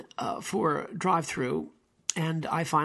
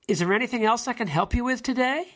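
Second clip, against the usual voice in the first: higher, lilting customer-service question; scripted offer of help, voice raised; imitated bank teller; 6 semitones higher, 4 dB louder than usual. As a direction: imitate a helpful teller, lifting at the end. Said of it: "It always goes like this".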